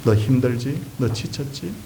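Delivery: soft, low-key voiced questions; quiet, gentle counsel. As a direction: imitate God gently checking on someone weary. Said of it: "조용하게 타이르시죠".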